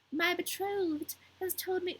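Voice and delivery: a touch of a grand court crow; fancy court crow. Acted. The court crow greeting Gerda in a slow fancy voice. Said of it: "this is the fancy court crow".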